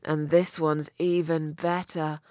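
muffled, dropping very soft; fading muffled murmur. Acted. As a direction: trail off into a muffled hum. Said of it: "his muffled voice said".

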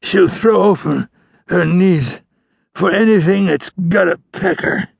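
high, dragging, slurred outlaw voice; labored speech. A narrator's reading. Dying outlaw gossiping to the ranger, labored and slurred. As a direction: push each word out with effort. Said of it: "more difficult for him to form his words".

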